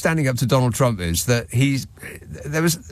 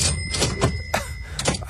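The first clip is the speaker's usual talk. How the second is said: high squealing laugh; 6 dB quieter than usual. Real, not acted. Laughs at his own Trump joke.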